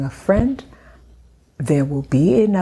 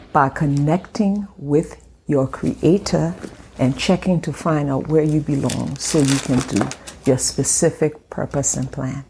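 normal talking voice

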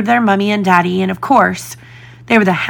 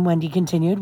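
ordinary delivery, nothing marked

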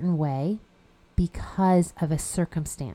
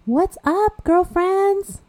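excited greeting; 12 semitones higher, 5 dB louder, much slower than usual. Real, not acted. Cheery greeting to listeners.

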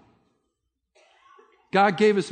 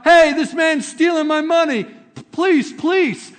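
much higher, much louder cry for help; yell out; 10 semitones higher, 7 dB louder, slower than usual. Retold or imitated. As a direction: shout it so the whole street hears. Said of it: "and yell out".

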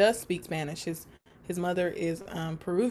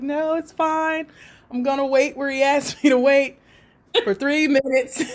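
amused reply; 5 semitones higher, 5 dB louder than usual. Retold or imitated. Her reply, laughing.

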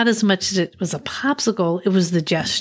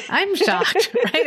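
a high, laughing exclamation; laughing surprise; 11 semitones higher, slower than usual. Real, not acted. Host reacting to the guest's story, high and laughing. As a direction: squeal it out through a laugh.

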